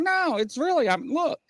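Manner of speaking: high-pitched insistent pleading; retold protest. Father insisting to doubting daughters, retold protest.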